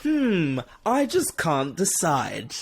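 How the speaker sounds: slow, heavily hinting comic-book character; high, slow mock deliberation; Harvey Dent. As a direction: drag it out with a knowing, hammed-up ponder. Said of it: "he'll keep being like".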